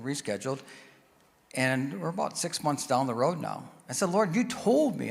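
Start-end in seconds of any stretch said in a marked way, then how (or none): 4.1-5.1 pitched higher, slow and pleading; a touch of frustrated complaint to God; mild retold frustration, drawn out. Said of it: "I'm getting frustrated with God".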